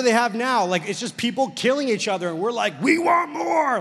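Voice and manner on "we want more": high, loud, drawn-out mock roar; hyped crowd yell. Preacher mocking horror fans who crave sequels, hyped yell. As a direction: yell it like a greedy movie crowd.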